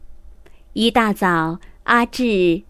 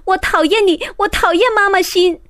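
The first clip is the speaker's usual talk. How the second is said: put-on much higher and louder, fast child outburst; 8 semitones higher, 4 dB louder, faster than usual. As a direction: blurt it out loud at the teacher. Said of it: "阿志大声地对老师说". (阿志冲着老师大声喊)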